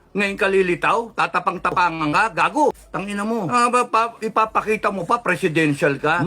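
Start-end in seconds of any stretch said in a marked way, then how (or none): none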